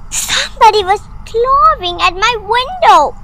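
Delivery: high, loud, panicky voice; frightened child. Acted. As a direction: blurt it out in fear. Frightened child tells father.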